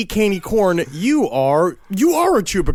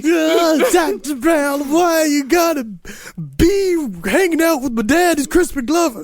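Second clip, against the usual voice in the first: high, drawn-out whining teenager impression; hammed-up high-pitched movie reenactment; Michael J. Fox; 10 semitones higher than usual. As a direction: plead slowly in a high, silly voice. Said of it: "That's the only Michael J. Fox I remember".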